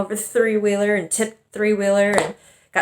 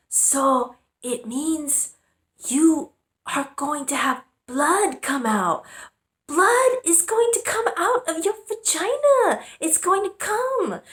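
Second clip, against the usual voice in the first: much higher, slow and ominous mock-mom voice; imitating her mom; 9 semitones higher, slower than usual. Mom warning about blood, high slow imitation. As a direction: announce it like grave news, pitched up. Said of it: "And she goes".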